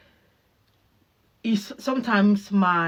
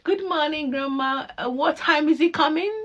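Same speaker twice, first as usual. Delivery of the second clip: sweetly eager; 6 semitones higher than usual. Retold or imitated. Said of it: "I'll be the one to wake them".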